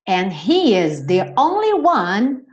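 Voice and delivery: slow, careful English sentence; slow model reading. Shown as a demonstration. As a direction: read it slowly for learners. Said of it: "slow teacher model".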